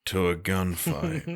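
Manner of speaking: slow, soft gruff movie-hero line; Harrison Ford. Retold or imitated. Quoting the old action hero to friends at school, slow and soft. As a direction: drawl it out like an aging action star. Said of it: "The bit where he's like".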